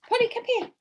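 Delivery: high calling voice, Polly lifted high; high-pitched call to a pet; calling the dog. Real, not acted. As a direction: sing it out high to fetch the puppy.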